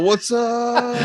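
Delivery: high, drawn-out parody greeting; mock host greeting. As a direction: send up the host's opener way high. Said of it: "He's all".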